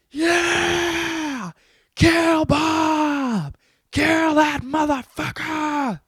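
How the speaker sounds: high, loud cheering yell; cheering on the machete revolt. Retold or imitated. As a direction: whoop for the kill, stretched out.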